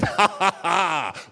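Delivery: loud booming laugh; loud hearty ha ha ha; big laugh. A narrator's reading. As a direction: Naomi laughs heartily at the clever trick. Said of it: "let out a big laugh".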